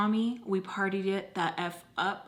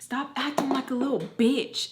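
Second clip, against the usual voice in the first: mom's harsh voice; 4 semitones higher than usual. Retold or imitated. Mom's hurtful words quoted.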